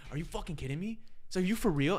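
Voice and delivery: retold outrage. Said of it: "literally lost his mind".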